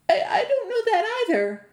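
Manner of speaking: a half-laughing, half-crying panic; stammering fear. A narrator's reading. Andy stammers his answer to the riddling giant, terrified.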